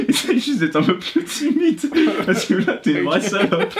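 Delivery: laughing. Real, not acted. Laughing after surprise song.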